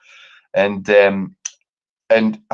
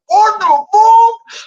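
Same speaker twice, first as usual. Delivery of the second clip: very high, loud and drawn-out; stunned outrage; 6 dB louder, much slower than usual. Retold or imitated. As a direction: drag it out in shock. Shocked at champagne order.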